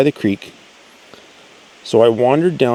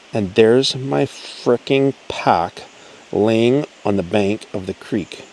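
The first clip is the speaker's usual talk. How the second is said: normal talking voice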